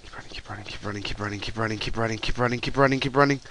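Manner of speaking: whispered low, tense urging; urgent whisper. Real, not acted. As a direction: whisper it under your breath, tense. Whispered urging while fleeing.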